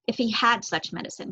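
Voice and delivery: usual speaking voice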